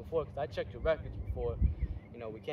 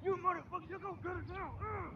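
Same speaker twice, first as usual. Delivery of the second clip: put-on much higher yells fading as the kid runs; furious pained yelling; 12 semitones higher, 11 dB louder than usual. Coach punched, yelling at the fleeing kid. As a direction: scream after him, hurt and furious.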